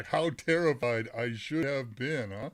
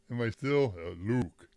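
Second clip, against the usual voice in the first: ordinary talk, nothing marked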